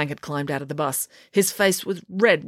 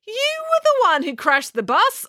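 arguing. A narrator's reading. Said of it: "argued April".

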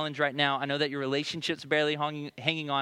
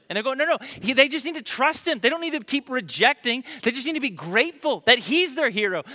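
higher, louder impassioned sermon; preaching at full force; 8 semitones higher, 7 dB louder than usual. Acted. Pastor explaining Paul's warning, impassioned preaching. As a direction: plead it to the whole room.

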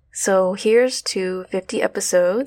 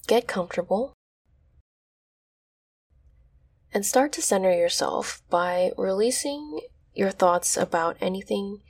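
guided meditation. Acted. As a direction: ease them into stillness.

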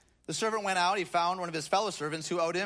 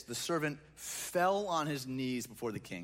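normal talking voice

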